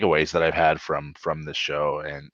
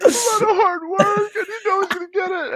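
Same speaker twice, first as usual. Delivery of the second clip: very high, loud, fast crying; high tearful shouting; sobbing; 6 dB louder than usual. Retold or imitated. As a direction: spill it out in tears. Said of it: "I was like".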